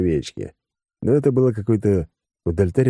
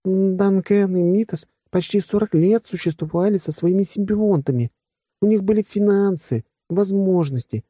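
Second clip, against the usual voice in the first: very high, steady female character voice; earnest blonde; 14 semitones higher than usual. Acted. Blonde arguing for the better bodies, high steady voice. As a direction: argue the case in a raised, even pitch.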